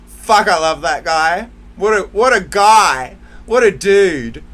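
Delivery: loud, delighted exclaiming; excited gushing. Real, not acted. Vlogger raving to viewers about her partner, loud and elated. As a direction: shout your love for him.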